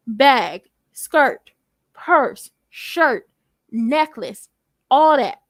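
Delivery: each item from Bag to all that punched separately, with a pause after it; punched list. Real, not acted. Host itemising a designer outfit, each word of Bag through all that punched alone. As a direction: hit Bag, skirt, purse, shirt, necklace one by one.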